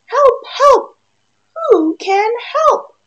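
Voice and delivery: high, loud cry for help; a stuck duck yelling high and loud; squeaky desperate duck shout; duck calling for help. Acted. Duck cries out in story.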